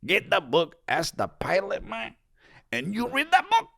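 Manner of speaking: put-on high strained Mexican accent. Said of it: "Why is your Mexican voice someone under immense physical strain".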